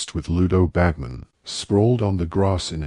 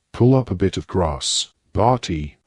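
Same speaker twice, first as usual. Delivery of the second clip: usual speaking voice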